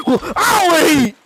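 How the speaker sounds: put-on high, loud childish refusal; mock sulk. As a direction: imitate someone sulking over dinner, hammed-up. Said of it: "Took it to the extreme".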